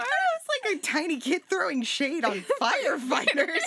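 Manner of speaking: laughing. Real, not acted. Laughing at own writing.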